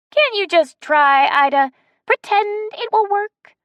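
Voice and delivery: put-on high, bright old lady coaxing; Betsy's voice. A narrator's reading. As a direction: coax them like a sweet old lady. Betsy pleading.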